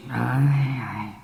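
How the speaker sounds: breathy whispered aye. Acted. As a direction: let it slip out under the breath. Captain murmurs aside.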